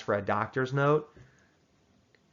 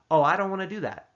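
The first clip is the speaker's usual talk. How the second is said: clear friendly decline; 6 semitones higher, 4 dB louder than usual. Shown as a demonstration. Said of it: "as clear as you possibly could be".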